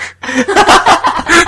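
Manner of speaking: squealing laugh, high; high burst of laughter. Real, not acted. Telling friends about the seizing cat, high squealing laugh. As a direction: break into a high cackle.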